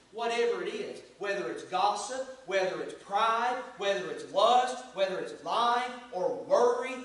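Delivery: rising, insistent pulpit cadence; impassioned preaching. Real, not acted. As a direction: preach it with mounting force. Preaches at full force.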